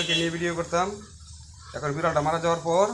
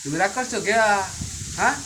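cooing pet talk; 3 semitones higher than usual. Real, not acted. Owner asking her sulking cat why it's angry, high cooing baby talk. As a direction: coax a sulky cat in a cooing voice.

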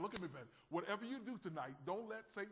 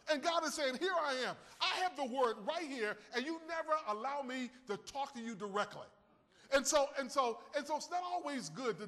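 louder, higher preaching at full force; 5 semitones higher, 4 dB louder than usual. Acted. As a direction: preach it out to the congregation, voice raised.

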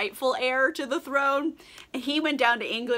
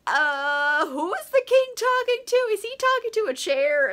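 much higher, puzzled voice; baffled guests; 10 semitones higher, 3 dB louder than usual. Retold or imitated. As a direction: sound weirded out by the king. Confused guests question the king.

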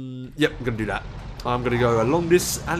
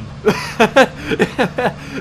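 high burst of real laughter; laughing; 8 semitones higher, 4 dB louder than usual. Real, not acted. Player caught by police gunfire, high laughter. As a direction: laugh helplessly, high and long.

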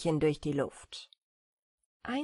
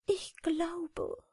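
high, timid sorrowful voice; quiet and sad; 10 semitones higher, 3 dB quieter than usual. A narrator's reading. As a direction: speak softly and sadly, pitched high. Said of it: "sagte leise und traurig Notburger". (leise und traurig, mit hoher Stimme)

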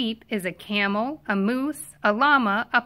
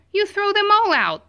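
much higher, louder final punchline; emphatic climactic answer; 8 semitones higher, 4 dB louder than usual. A narrator's reading. Reader's climactic answer to children, high and loud. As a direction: shout the big finish with glee.

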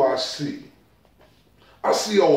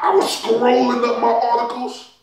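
normal talking voice

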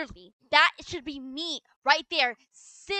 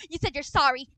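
ordinary talk, nothing marked

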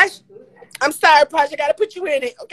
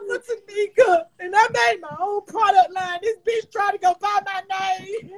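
tearful, pitched high and breaking, louder at the peak; crying; 8 semitones higher, 3 dB louder than usual. Real, not acted. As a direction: sob it out, voice climbing and cracking.

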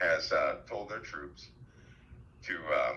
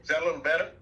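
pitched up and raised, testing volume; talking louder; 9 semitones higher, 6 dB louder, much faster than usual. Real, not acted. Man checking if listeners hear better, talking louder. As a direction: project a bit more, checking it carries. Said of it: "I'll just have to talk louder".

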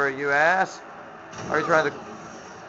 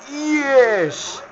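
much higher, excited yes, yes; high triumphant yes; 10 semitones higher than usual. Real, not acted. Gamer celebrating the win, high excited yes. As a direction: cheer it up high.

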